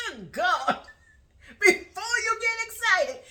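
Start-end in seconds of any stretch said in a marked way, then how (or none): 1.6-3.2 high, loud mock warning; mocking repeat. Girlfriend repeating boyfriend's words to audience, mocking. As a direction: mimic his warning at raised pitch and volume.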